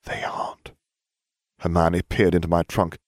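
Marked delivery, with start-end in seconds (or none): 0.0-0.7 very soft whispered aside; hushed, secretive whisper. A narrator's reading. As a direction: lean in and whisper it. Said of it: "I leaned in to whisper".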